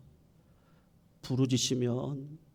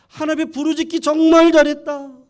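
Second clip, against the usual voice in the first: much higher and louder, jubilant; a mother shouting her thanks to God; joyful cry; 14 semitones higher, 15 dB louder than usual. Acted. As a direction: ecstatic outburst of praise. Mother rejoicing over the oil.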